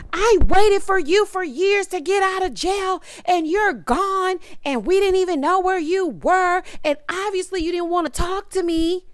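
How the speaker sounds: high, mock-whiny imitation of Zariah. Reviewer mocking Zariah's complaint to Troy, high mock whine. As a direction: mimic her shrill complaint, pitched high. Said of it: "she's telling him".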